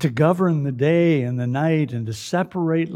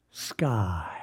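whispered; 3 semitones lower, 5 dB quieter than usual. Acted. Dramatic drop after booming line.

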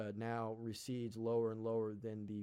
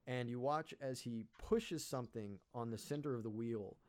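normal talking voice

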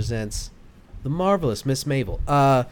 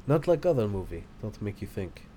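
put-on broken English, Russian accent; clipped mock Russian, dropped articles; 7 dB quieter than usual. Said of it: "That's a better Russian accent".

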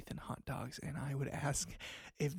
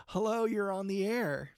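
radio host greeting; 5 semitones higher, 5 dB louder than usual. Acted. Host takes a caller.

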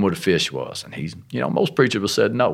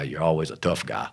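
ordinary talk

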